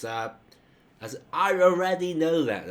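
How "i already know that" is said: high, loud, impatient retort; frustrated. Acted. Showing what repeated hi implies, frustrated and impatient. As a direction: cut them off with exasperated insistence. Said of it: "you're frustrated".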